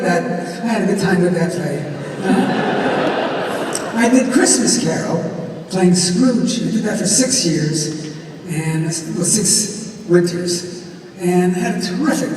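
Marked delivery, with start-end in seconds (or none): none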